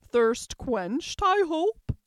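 loud question rising high; brisk queen. A narrator's reading. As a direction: ask loudly as an imperious queen.

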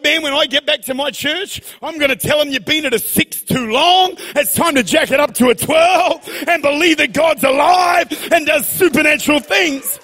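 loud, slow, building preacher cry; fiery preaching shout. Real, not acted. Preacher urging his church to jack it up, fiery preaching shout. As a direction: rouse the church to turn it up.

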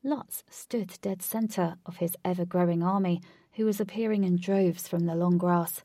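plain reading voice

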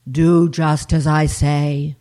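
low, loud, slow command; voice of God. Acted. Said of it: "said the voice".

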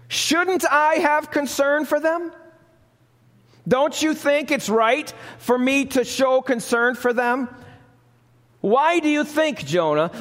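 preacher lightly voicing God pleading with Jonah. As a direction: ask Jonah as a yearning God. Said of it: "God's voice addressing Jonah".

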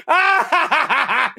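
gleeful evil cackle; evil laughing. Real, not acted. Gloating about angering the left, evil cackle. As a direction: cackle like a villain. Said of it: "after all that evil laughing".

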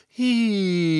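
long vowel glide from high note downward; siren-like vocal slide starting very high; pitch slide demo. Shown as a demonstration. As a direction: slide the voice from the top of the range. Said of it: "It sounds like this".

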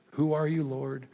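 a private, hushed prayer; soft inward prayer. Shown as a demonstration. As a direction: ask it quietly, to yourself. Second take of the prayer question.

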